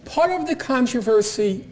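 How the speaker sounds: normal talking voice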